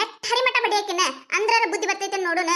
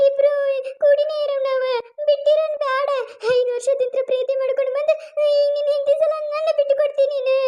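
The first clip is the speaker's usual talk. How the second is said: mock crying; 6 semitones higher than usual. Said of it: "ನೀ ಯಾಕ್ ಅಳ್ತಿ ಚಿನ್ನು?".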